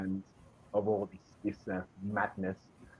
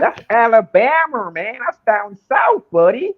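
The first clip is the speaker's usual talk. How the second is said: much higher and louder hammed-up American Southern voice, slow; mock Southern accent; 13 semitones higher, 16 dB louder, slower than usual. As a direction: play along in a big cartoon Southern accent, laughing at the end. Joins the mock Southern banter.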